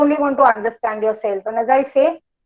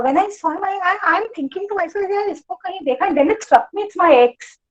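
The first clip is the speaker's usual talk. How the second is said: dawning recognition; 7 semitones higher than usual. Retold or imitated. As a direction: relive the moment it clicks who he is. Acting out sudden recognition of ex.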